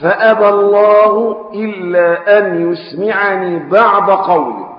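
slow formal classical Arabic reading; hadith recitation. A narrator's reading. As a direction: read the text slowly and solemnly. (قراءة الحديث ببطء ووقار)

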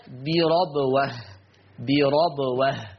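slow بربوة with "a" after the ra, "birabwah"; fatha on ra. Shown as a demonstration. Teacher shows the Hafs reading, بربوة with fatha. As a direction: open the ra of بربوة to "ra". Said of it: "حفص عن عاصم يقرأ بفتح الراء".

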